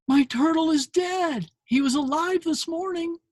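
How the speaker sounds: put-on high, tearful little girl voice; upset little girl. Girl voiced in retold comic.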